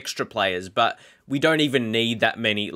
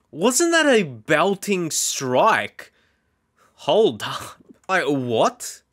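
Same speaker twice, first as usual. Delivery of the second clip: higher and drawn out slow, Italian-free pure delight at the Italian-league goal; excited; 5 semitones higher, much slower than usual. Real, not acted. Player admiring his team's goal, higher excited drawl. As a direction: marvel at the strike, stretching it out.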